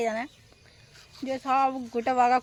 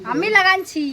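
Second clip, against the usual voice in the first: raised voice, pitched high; calling out; 8 semitones higher, 9 dB louder than usual. Real, not acted. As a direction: call out to stop someone. Calling out to someone nearby. (উঁচু গলায় ডাকা)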